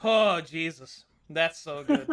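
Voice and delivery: ordinary delivery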